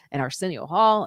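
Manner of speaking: ordinary talk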